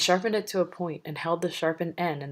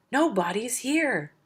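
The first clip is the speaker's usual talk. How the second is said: high, strained shout; 8 semitones higher, 3 dB louder than usual. Acted. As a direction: howl it out, desperate. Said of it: "Polyphemus shouted".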